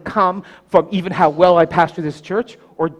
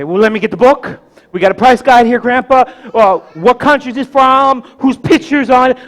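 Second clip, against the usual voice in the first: lightly voiced keen kid, a bit higher and louder; eager grandson; 6 semitones higher, 7 dB louder than usual. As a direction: reel off questions to grandpa. Voices himself as eager child.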